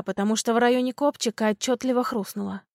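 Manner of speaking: ordinary delivery, nothing marked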